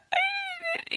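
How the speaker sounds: a high, cracked sob-cry imitation; mimicked crying sob. Retelling breaking down in the counselor's office, mock sob. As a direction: let out a strangled cry like breaking down. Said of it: "and I immediately start crying".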